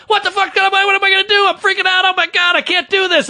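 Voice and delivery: high-pitched frantic inner freak-out; panicking. Retold or imitated. Comic voicing his head while looking normal, panicked. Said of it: "I'm panicking".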